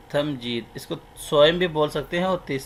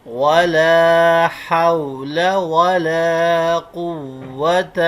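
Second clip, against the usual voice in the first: slow, drawn-out Arabic recitation, word by word; 2 semitones higher, 6 dB louder, much slower than usual. Shown as a demonstration. Teacher reciting the kalima slowly for students to follow. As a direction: chant each word long for the class to repeat.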